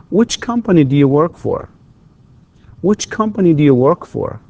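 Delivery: high "Which company", stepping down to "work for"; falling intonation. Shown as a demonstration. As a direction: let "Which company do you work for" fall steadily. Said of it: "it's falling intonation".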